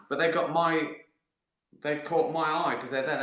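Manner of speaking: usual speaking voice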